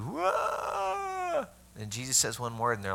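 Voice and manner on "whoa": hammed-up professional mourner's wail, very high; mock wail soaring up in pitch; a paid mourner's fake cry of grief. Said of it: "They know how to".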